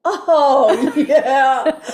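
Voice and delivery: loud, laughing, high outburst; elated relief. Retold or imitated. Speaker relives getting company to go up, elated.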